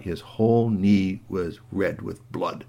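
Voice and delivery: ordinary delivery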